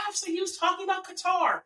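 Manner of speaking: high, quick correcting voice; mock nitpicker. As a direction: mimic someone butting in to correct.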